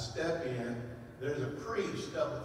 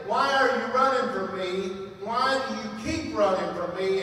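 louder, higher, pleading call; booming divine voice; 5 semitones higher, 6 dB louder than usual. Retold or imitated. The Lord calling to the soldier through the priest, booming and pleading. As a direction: call out like God confronting a runaway.

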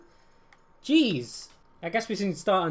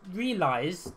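ordinary delivery

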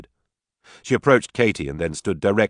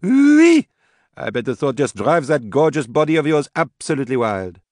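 put-on high, showy drunken tease; brash, teasing Katie; 8 semitones higher, 5 dB louder than usual. A narrator's reading. Tipsy Katie taunts her sister before the wedding, brash teasing. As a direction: tease loudly in a raised pitch.

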